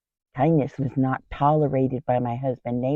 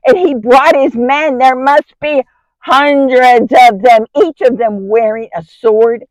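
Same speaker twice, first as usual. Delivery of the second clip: louder, higher, mounting alarm; acted alarm; 5 semitones higher, 8 dB louder than usual. Wife sees hundreds of angry men coming over the hill, alarmed. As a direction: build to awed panic at the sheer numbers.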